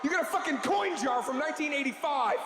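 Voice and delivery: high, raging leather-jacket tough guy screaming at the viewer; furious tough guy. Acted. Leather jacket guy berating stingy viewers, furious yelling. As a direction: scream it like you want to shake them. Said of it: "a fucking dude in a leather jacket".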